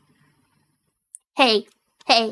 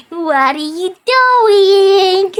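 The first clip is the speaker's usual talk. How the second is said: slow drawn out; 3 dB louder, much slower than usual. Pretend. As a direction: stretch every word very slowly. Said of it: "drawn out repeat".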